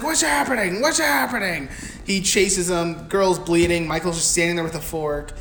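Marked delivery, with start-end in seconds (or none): 0.0-1.7 high, mock-alarmed questions; Loomis. Doctor as the guards start coming, imitated high alarm. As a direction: imitate a panicked doctor, pitched up. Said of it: "and Loomis is like".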